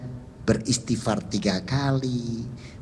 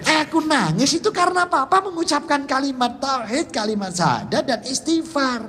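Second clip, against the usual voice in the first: tearful explanation; 14 semitones higher than usual. Retold or imitated. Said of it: "nangis saya".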